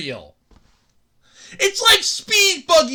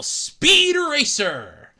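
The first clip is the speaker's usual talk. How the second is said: drawn-out title; much slower than usual. Shown as a demonstration. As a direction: Speed Racer stretched slowly like an announcer. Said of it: "because Mach Go Go Go just does not roll".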